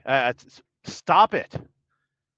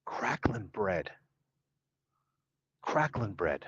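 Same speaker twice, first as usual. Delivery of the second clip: whispering; 5 semitones lower, 8 dB quieter, slower than usual. Real, not acted. Awed murmur of strange food.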